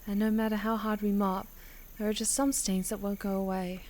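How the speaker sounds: softly voiced Holly, wistful then earnest; lightly voiced girl, gently wistful; quiet prayer reading. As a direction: read it like a private prayer-diary. Reads Holly's quiet reflection and prayer.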